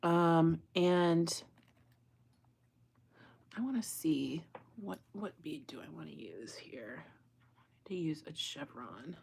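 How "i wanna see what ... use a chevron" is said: whisper to self. Real, not acted. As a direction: whisper the thought to yourself. Muttering to herself while choosing beads.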